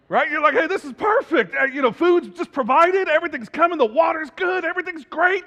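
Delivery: high, loud, animated sermon storytelling; excited preaching. Acted. Preacher picturing paradise for the congregation, excited.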